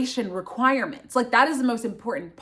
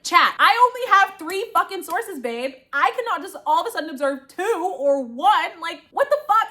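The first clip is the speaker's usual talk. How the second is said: exasperated; 8 semitones higher than usual. Retold or imitated. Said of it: "And I'm like".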